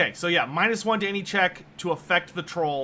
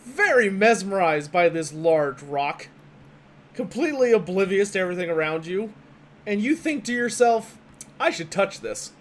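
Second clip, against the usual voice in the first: game master narration; 4 dB louder than usual. Acted. GM sets the scene.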